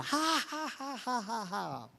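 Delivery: high mock cackle fading away; mocking laughter. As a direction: imitate a possessed woman's sneering laugh. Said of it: "el llanto se convirtió en burla".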